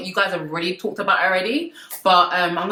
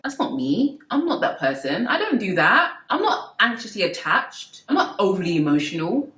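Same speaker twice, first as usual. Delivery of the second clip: ordinary talk, nothing marked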